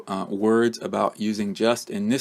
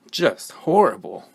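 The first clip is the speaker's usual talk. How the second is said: just and horrible both stressed, horrible punched; JUST HORRIBLE, firm and weighted. Shown as a demonstration. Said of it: "we stress the word just along with the adjective".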